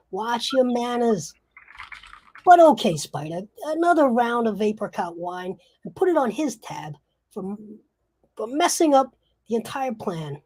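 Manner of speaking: loud, high wiseguy reprimand; mobster putting someone down. Acted. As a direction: pull rank on an upstart gangster. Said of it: "The other person says".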